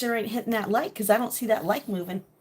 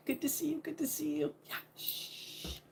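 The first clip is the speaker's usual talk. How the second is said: real whisper, higher; 7 semitones higher, 9 dB quieter than usual. Real, not acted. Mom greeting chat viewers while her kids sleep, whispering. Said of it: "I know I'm whispering today".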